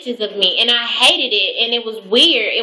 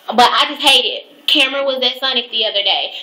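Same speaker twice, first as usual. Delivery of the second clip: ordinary talk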